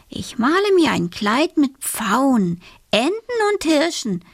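put-on high, lilting girl planning aloud; little girl character. A narrator's reading. Alice plans her new dress aloud, high and lilting. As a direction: voice a girl delighted with her idea. Alice talks to herself while painting.

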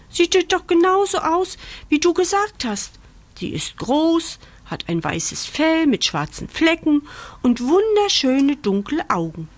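high-pitched little bunny voice; insisting rabbit. A narrator's reading. Rabbit repeats puppy's description back.

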